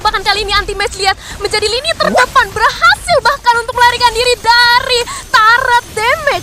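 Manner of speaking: excited. Real, not acted. Caster builds during fight.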